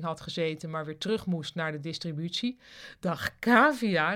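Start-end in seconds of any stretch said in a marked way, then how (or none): 3.0-4.1 slow, loud, higher-pitched greeting; calling out. A narrator's reading. Marja greets Kavia at the drinks, calling out. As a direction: hail a colleague with drawn-out gusto. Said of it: "riep Marja".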